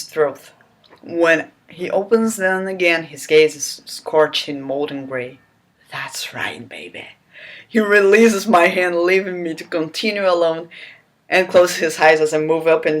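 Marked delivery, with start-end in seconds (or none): 5.9-7.1 breathy sultry whisper. Acted. Hero whispering to the heroine, hushed and low. Reading the hero's line.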